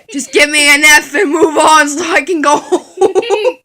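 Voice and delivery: giggling give-up plea; laughing plea. Real, not acted. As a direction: beg while cracking up.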